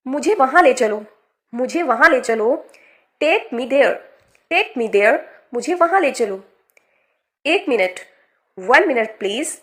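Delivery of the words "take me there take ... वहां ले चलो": ordinary talk, nothing marked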